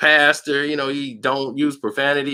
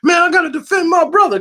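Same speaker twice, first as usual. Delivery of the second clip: much higher, louder hammed-up protest; mock-heated high outburst; mocking mad Jamal; 5 dB louder than usual. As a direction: overplay the protective brother. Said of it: "Jamal get all mad".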